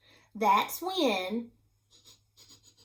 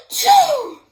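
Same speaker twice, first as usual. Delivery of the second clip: hammed-up enormous sneeze; huge sneeze; 14 dB louder than usual. Wolf sneezes at the brick house, loud and hammed up. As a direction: gasp then a loud, very high achoo. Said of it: "and I sneezed once again".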